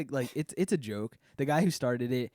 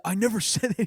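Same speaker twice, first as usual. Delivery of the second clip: higher, louder, laughing through never; mock-indignant I never said that, breaking into a laugh; doubling down; 8 semitones higher, 5 dB louder, faster than usual. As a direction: insist again, cracking up on never. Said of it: "He starts, starts doubling down".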